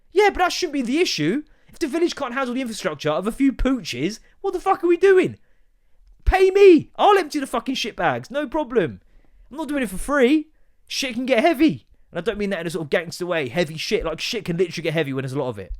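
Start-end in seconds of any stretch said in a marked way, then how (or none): 0.1-5.4 high, exasperated retort; worked-up, high-pitched protest; indignant rant. Acted. Arguing back at commenters. 6.2-6.9 very high, loud comic yell; high drawn-out holler; shouted demand. Acted. Demanding money in mock outrage. 7.0-11.9 high, loud, worked-up bargaining; exasperated comic ranting; indignant rant. Acted.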